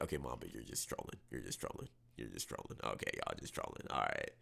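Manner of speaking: low, very soft grumble; muttered dismissal. Real, not acted. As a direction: mutter it to yourself, not buying it. Dismissing chat's joke birth years.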